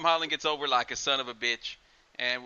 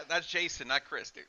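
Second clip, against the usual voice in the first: ordinary talk, nothing marked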